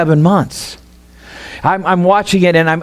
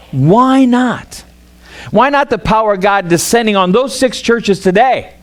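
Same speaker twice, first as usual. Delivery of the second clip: rally the church with mounting fervor; building sermon cry; 3 semitones higher than usual. Acted. Pastor urges six churches, building to a higher passionate preach.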